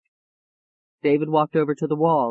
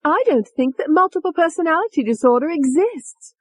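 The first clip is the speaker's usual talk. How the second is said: much higher, mocking pompous doctor; mimicking a doctor; 12 semitones higher, 4 dB louder than usual. Tony mimicking a doctor who doubts her, mocking. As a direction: parody a stuffy expert with a sneer. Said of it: "She mimicked one of their voices".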